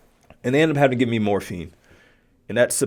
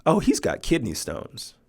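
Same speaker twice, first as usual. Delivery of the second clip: matter-of-fact; 5 dB quieter than usual. Retold or imitated. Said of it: "as a matter of factly as she could".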